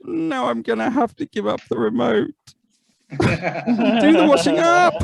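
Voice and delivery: high, loud, slow shock; mock man waking in a woman's body, squealing in horror; mock horrified gasp. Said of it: "You'd be like".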